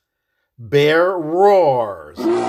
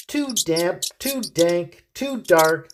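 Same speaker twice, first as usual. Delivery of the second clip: squeaky mouse voice; 4 semitones higher than usual. Acted. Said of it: "Mouse squeaks".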